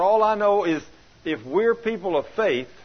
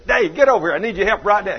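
hollering at Dave; 4 semitones higher, 7 dB louder, faster than usual. Retold or imitated. Hollering at a male worker.

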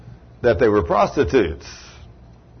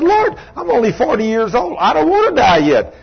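high, loud acted plea; hammed-up desperate begging; begging for life; 11 semitones higher, 4 dB louder than usual. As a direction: plead in a raised voice, imitating a dying man. Dying man begs God for life.

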